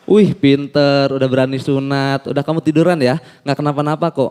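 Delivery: put-on calm the scared kid; soothing doctor. (dokter menenangkan anak)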